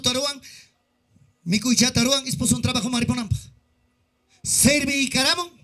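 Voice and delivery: ordinary delivery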